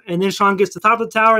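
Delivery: usual speaking voice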